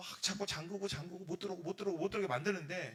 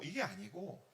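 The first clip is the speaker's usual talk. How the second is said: usual speaking voice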